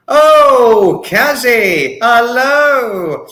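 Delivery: high, loud sing-song hello; excited greeting. Real, not acted. Streamer greeting a regular viewer, high and loud.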